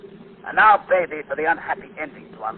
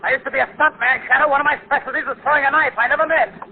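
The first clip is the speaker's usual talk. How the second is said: high, loud, wild-eyed snarling threats; furious threat; 11 semitones higher, 8 dB louder than usual. Acted. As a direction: menace them like a cornered madman. Cornered killer threatens with knife.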